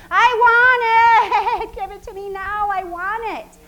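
high, loud, slow demanding whine; craving body's voice. Retold or imitated. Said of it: "Your body will say".

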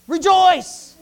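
Rejoice burst out high and loud; shouted proclamation. Acted. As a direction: shout Rejoice to the whole room. Preacher proclaiming the key word.

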